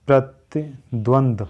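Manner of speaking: प्रतिद्वंद slowly, pra-ti-dvand; drawn out प्रतिद्वंद; slow careful form. Shown as a demonstration. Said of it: "शुद्ध हो जाएगा". (प्रतिद्वंद धीरे उच्चारण)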